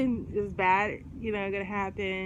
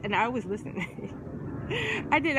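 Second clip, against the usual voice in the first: ordinary talk, nothing marked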